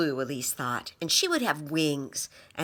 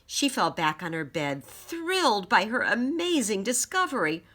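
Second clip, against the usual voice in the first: ordinary delivery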